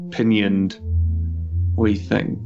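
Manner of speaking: ordinary talk, nothing marked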